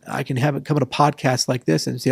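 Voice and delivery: ordinary talk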